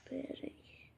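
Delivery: soft whisper. Real, not acted. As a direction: whisper it under your breath. Quiet pause while building.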